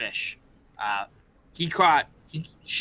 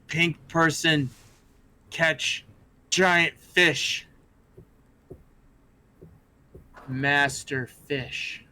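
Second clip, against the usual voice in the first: slow, broken kobold speech; slow creature-talk praise; much slower than usual. Acted. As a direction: drag the words out like a simple creature. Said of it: "and Crowd goes".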